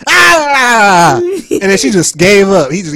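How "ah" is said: loud exasperated shout; mother's frustrated yell. Retold or imitated. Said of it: "She goes".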